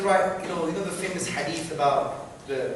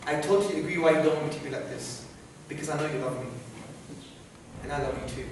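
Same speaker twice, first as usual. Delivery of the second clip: softer, tender appeal to a parent; empathizing; 3 semitones lower, 5 dB quieter than usual. Pretend. Son winning over his angry parents, empathizing. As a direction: reassure a parent gently, in a hushed sincere voice. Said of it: "by empathizing with them and say".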